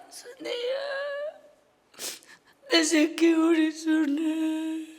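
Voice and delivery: slow, tearful lament with a sung edge; keening over her child; mother sobbing. Retold or imitated. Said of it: "이러면서 그냥 울었어요". (노래하듯 우는 엄마)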